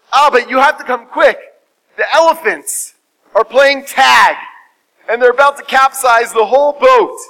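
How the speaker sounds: high, loud, emphatic report; urgent alarm. Acted. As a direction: raise the alarm as a frantic son, acted. Son raises alarm second time.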